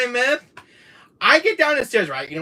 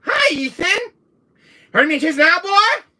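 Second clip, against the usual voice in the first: much higher, loud Louisiana taunt; Jack's voice; 13 semitones higher, 7 dB louder than usual. Retold or imitated. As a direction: greet Ethan like the waking villain, loud and high. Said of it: "I was like".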